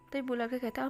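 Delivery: ordinary delivery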